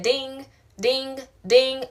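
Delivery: high sing-song imitation of a phone ding. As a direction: chime it out like a notification. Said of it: "My phone said".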